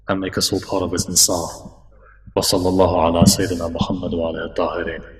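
low, fast recited supplication; closing prayer. Acted. Preacher leading the congregation in the closing dua, quick low recitation.

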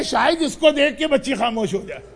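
ordinary talk, nothing marked

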